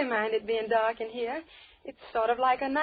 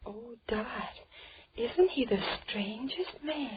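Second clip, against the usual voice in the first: whisper; 2 semitones lower, 7 dB quieter than usual. Acted. Whispering about the strange host.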